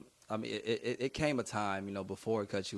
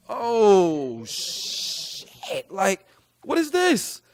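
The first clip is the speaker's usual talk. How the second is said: much higher and louder amazed cry, slow at the end; mock amazed; 11 semitones higher, 14 dB louder, much slower than usual. Rapper hearing the beat for the first time, high loud amazed cry. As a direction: blurt it out, stunned by the beat.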